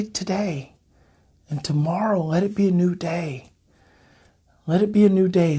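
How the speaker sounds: closing blessing. Acted. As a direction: bless them gently, like a prayer.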